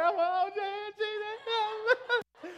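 hammed-up teary sigh to Jesus; mock tearful. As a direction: choke up over it in jest. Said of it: "And I'm like".

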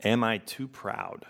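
unhurried questions with room to sit with them; slow reflective reading. Real, not acted. Pastor giving listeners a moment with each question, read slowly. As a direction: read each question slowly, leaving long silences after. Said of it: "just gonna read them slowly".

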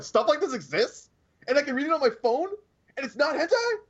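giddy mock astonishment; amazed disbelief. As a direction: relive the amazement in a high squeal. Retells own discovery of manga.

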